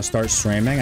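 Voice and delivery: ordinary talk, nothing marked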